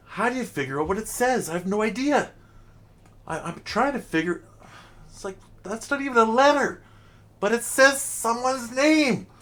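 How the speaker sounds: high-pitched, incredulous bafflement; baffled exasperation. Real, not acted. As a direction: push the voice way up in exasperated confusion. Acting baffled by unreadable graffiti.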